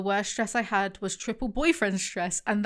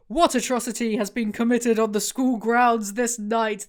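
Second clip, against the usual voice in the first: a lightly voiced booming, commanding headmistress; thundering voice; 5 dB louder than usual. A narrator's reading. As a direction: boom it out like an outraged authority. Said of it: "the voice thundered".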